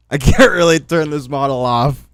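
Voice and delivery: laughing through the words. Real, not acted.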